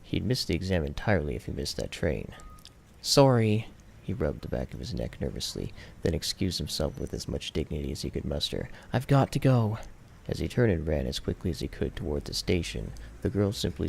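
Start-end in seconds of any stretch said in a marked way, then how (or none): 3.0-3.7 louder, higher rushed apology; hurried sorry. A narrator's reading. 8.9-9.8 louder, higher rushed goodbye; hurried exit. A narrator's reading. Hurrying to catch train.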